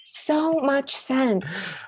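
stretched, very slow echo; very slow deadpan. Acted. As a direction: drag every word out. Repeats even slower.

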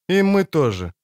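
plain reading voice

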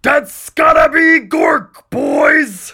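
huge, high, drawn-out orc roar; bellowing orc. Acted. Orc captain struck by a thought, bellowing. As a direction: bellow the revelation at the gang. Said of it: "he turns to bellow".

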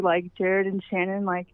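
usual speaking voice

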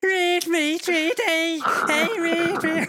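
high, squeaky mocking voice, very high up. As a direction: squeal it like a cartoon kid. Mocking a Scouse player's voice.

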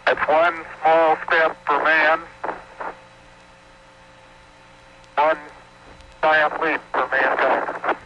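loud, slow, measured words; slow and deliberate. Real, not acted. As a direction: announce each word slowly and firmly. First step on the moon.

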